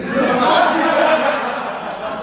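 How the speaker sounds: loud unvoiced laugh; real airy laughter; breathy laugh. Real, not acted. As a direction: wheeze with laughter. Laughs at A class reveal.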